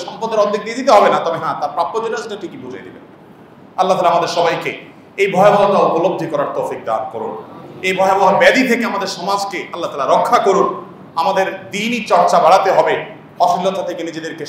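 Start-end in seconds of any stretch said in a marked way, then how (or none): 3.8-10.7 lower, earnest prayer voice; sermon prayer. Acted. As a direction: pray aloud for the listeners. Closing prayer for the community. (মোনাজাতের সুরে দোয়া)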